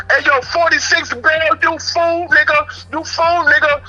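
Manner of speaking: high mock heckle; imitated high jeering from the cells; retold hollering. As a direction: holler it high like inmates mocking him.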